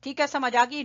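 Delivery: usual speaking voice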